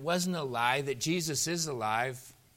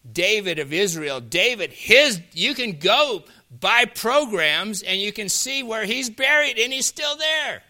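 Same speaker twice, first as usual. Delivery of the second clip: building to a high, loud shout; confident preaching; 5 semitones higher, 8 dB louder than usual. Acted. Peter tells the brethren of David's tomb, building loudly. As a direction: climb from firm declaration to full-force cry. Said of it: "Brethren, I may confidently".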